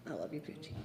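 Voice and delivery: very soft, almost fading away; tender farewell. Real, not acted. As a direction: say goodbye under your breath. Farewell at a memorial.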